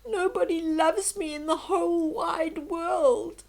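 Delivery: high, loud tearful wail; a little duckling sobbing his heart out. Acted. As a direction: cry it out like a hurt child. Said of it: "he sobbed".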